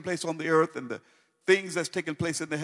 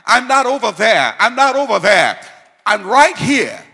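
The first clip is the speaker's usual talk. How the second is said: preacher's shout; 8 semitones higher, 14 dB louder than usual. Acted. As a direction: shout it out, much louder and higher. Preacher climax shouting to congregation.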